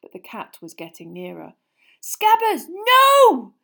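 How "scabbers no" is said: very high, loud cry; anguished shout. Acted. Ron shouting as the rat escapes. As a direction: yell out as he slips away.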